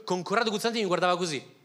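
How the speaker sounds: ordinary talk, nothing marked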